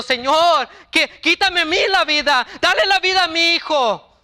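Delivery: grieving father pleading with God in anguish; desperate prayer. A narrator's reading. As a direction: beg God to take your life instead. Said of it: "la oración de David diciendo".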